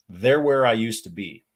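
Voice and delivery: usual speaking voice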